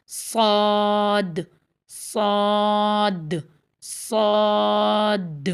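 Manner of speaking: long heavy صاد, mouth not spread; full heavy Saad. Shown as a demonstration. Teacher reads it without widening the mouth, heavy drawn-out صاد. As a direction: hold صاد with a full, dark emphatic s and vowel. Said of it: "اسی کو اب میں منہ چوڑا کیے بغیر پڑھوں گی".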